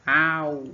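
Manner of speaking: "Au" shouted short and loud as a call; calling-out stress. Shown as a demonstration. Teacher shows the stressed "Au" as a call. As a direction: call "Au" out like hailing someone. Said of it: "como se estivesse chamando alguém".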